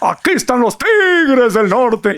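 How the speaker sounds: high, drawn-out DJ song intro; hammed-up band introduction, slowly stretched; norteña radio announcer. Host imitating a radio announcer introducing a band, hammed-up. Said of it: "un locutor de radio que era de música norteña y decía".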